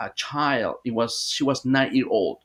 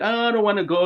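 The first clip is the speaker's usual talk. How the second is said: retold in a higher, lightly childlike voice; child's voice; 7 semitones higher than usual. Sister under hypnosis speaks as a reluctant child, higher pitched. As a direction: imitate the little girl refusing to go, pitched up. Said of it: "She changed her voice for a, a child".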